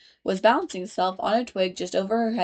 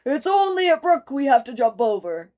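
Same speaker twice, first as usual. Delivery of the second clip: louder horse voice; 5 semitones higher, 5 dB louder than usual. A narrator's reading. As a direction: reassure everyone as the horse.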